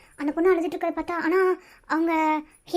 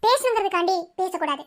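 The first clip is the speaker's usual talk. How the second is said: normal talking voice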